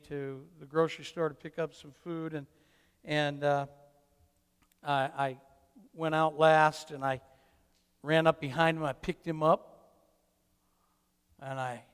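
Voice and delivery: ordinary talk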